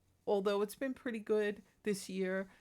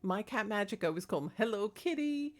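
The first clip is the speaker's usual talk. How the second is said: usual speaking voice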